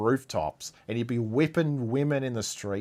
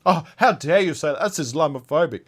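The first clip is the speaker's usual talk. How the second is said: quick, higher, louder mocking of an offended critic; sarcastic imitation of an outraged critic, fast and raised; mock outrage; 4 semitones higher, 4 dB louder, faster than usual. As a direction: parody the pearl-clutching "how dare you", fast.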